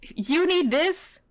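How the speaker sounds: high, exasperated rebuke; indignant scolding. Real, not acted. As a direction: tell him off in disbelief. Berates the trainer.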